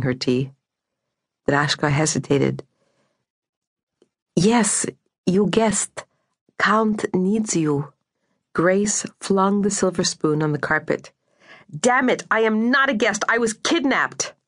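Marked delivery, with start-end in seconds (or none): 4.3-7.9 put-on higher, slowing Slovak-accented English; careful broken English in a Slovak accent; lilting Slovak accent. A narrator's reading. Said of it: "her Slovak accent soft and lilting". 11.7-14.3 exasperated, high-pitched protest; raised pitch, indignant and emphatic; angry outburst. A narrator's reading. Captive flings spoon, protests she was kidnapped.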